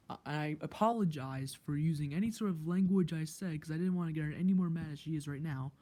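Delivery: usual speaking voice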